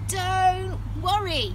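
high, slow, confident pig. Acted. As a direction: reassure like a brave pig. Pig reassures others.

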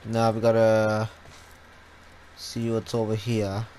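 ordinary talk, nothing marked

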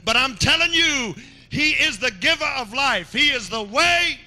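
high, loud proclamation; fervent witness. Acted. A man in the crowd proclaiming Jesus, loud and high. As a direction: proclaim him with fire. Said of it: "And he said".

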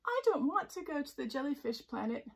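lightly voiced homesick jellyfish; plaintive Jellyfish. A narrator's reading.

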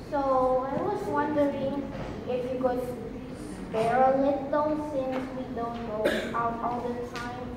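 soft, low, halting ask; hesitant request. Acted. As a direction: ease gently into asking Mom for a favor. Monologue: son timidly asks mom for money.

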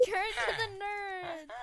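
slow laughing announcer imitation; commercial voice. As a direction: read it like a cheesy ad.

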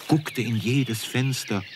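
ordinary delivery, nothing marked

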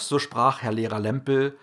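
plain reading voice

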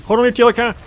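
high, loud attack shout; 1 mock battle cry. Player attacking enemies, loud high battle cry. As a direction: yell it like launching an attack.

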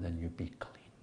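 a whispered moral at the story's end. Real, not acted. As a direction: breathe the lesson out under your voice. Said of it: "whispered lesson".

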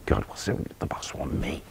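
breathy worried whisper to himself; nervous whisper. Retold or imitated. Young player fretting about being called to the boss, nervous whisper. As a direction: whisper it as if dreading the summons.

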